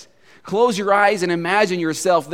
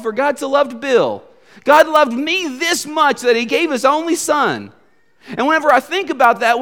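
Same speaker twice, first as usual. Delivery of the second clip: raised, higher preaching voice, driving each phrase home; impassioned preaching; 9 semitones higher, 5 dB louder than usual. Real, not acted. Preacher urging listeners to make the verse personal, impassioned preaching. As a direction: press the congregation with rising, emphatic repetition. Preacher builds to full-force exhortation.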